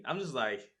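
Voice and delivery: usual speaking voice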